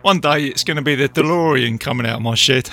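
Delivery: normal talking voice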